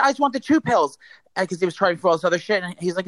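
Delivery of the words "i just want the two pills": high, exasperated insistence; retold snapping. Customer wanting only two pills, retold snapping. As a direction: re-enact a pleading, fed-up demand. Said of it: "I finally just snapped".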